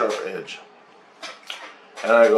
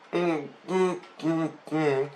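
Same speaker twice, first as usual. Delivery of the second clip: high, closed-mouth humming sounds; lipless alien hum; 7 semitones higher, 5 dB quieter, much slower than usual. Retold or imitated. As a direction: imitate talking with no lips. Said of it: "It's like he's talking like that".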